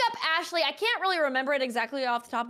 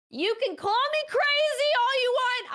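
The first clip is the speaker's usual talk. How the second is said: excited insistence; 11 semitones higher, 4 dB louder than usual. Real, not acted. Insisting her story is true.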